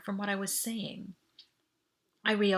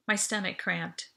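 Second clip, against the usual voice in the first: ordinary delivery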